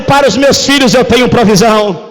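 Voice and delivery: loud, far-carrying proclamation of God's promise; booming declaration. Acted. Preacher voicing God to the congregation, booming. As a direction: proclaim it like God speaking over his children.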